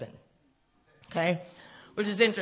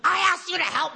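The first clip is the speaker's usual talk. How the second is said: much higher and louder exasperated plea; loud mock complaint; 10 semitones higher, 7 dB louder than usual. Scolding God for not helping, a loud exasperated plea. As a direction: throw the complaint up at the sky.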